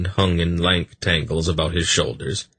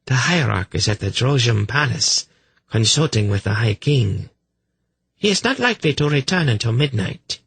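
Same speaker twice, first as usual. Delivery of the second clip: lightly voiced priestess, somewhat higher; haughty priestess; 6 semitones higher than usual. A narrator's reading. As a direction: inform a visitor with mild hauteur.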